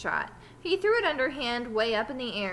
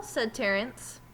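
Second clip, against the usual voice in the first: usual speaking voice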